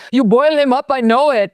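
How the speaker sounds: high, loud quoted line; movie line impression. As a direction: pitch it up, recite the line. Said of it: "little lines that I just constantly throw out".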